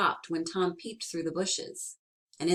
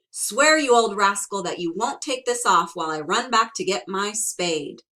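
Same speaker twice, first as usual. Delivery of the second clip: demanding oath; 3 semitones higher, 8 dB louder than usual. Acted.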